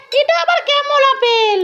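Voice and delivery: pretend voice. Mother voices a pretend figure.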